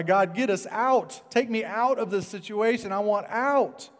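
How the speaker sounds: crying out to God. Acted. A believer begging God for rescue, acted desperate cry. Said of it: "sometimes we cry".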